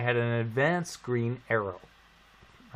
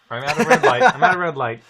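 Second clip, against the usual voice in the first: loud; 3 semitones higher, 10 dB louder, faster than usual. Real, not acted. Telling friends his story, loud and punchy. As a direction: belt out the start of the tale.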